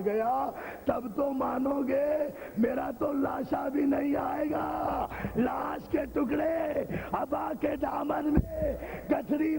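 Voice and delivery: slow, wailing lament, shouted high over music; preaching at full force. Acted. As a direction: cry it out to the mourners, stretching each phrase. (بلند آواز میں گریہ بھرا خطاب)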